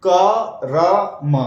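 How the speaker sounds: very slow, each sound apart; teacher sounding out क र म slowly; slow spelled-out syllables. Shown as a demonstration. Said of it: "इसका उच्चारण कैसे करेंगे".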